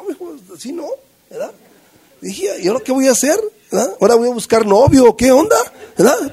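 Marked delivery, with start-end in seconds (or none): 0.0-0.9 lightly voiced girlfriend, much higher; girlfriend's put-down. Girlfriend turning him down, a high mild put-down. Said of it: "Me dijo". 2.6-6.3 higher and louder, comic alarm; hammed-up despair; mock panic. Panicking to himself after failing, loud mock alarm.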